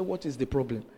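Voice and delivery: retold calm pastor. Pastor to the distraught mother, low calm question. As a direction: play the pastor asking quietly. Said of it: "Pastor said".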